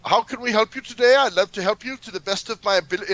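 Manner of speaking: high put-on voice; mock phone agent. Mocking the DirecTV agent Dina, high put-on voice. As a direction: parody a polite operator. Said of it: "she's saying".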